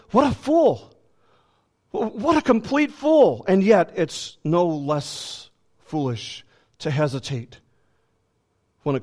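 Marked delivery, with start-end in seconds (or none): 0.1-3.4 high-pitched, exclaimed disbelief; incredulous scorn. Acted. Listener judging the prisoner who won't flee, incredulous scorn. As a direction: exclaim it high, appalled at such folly.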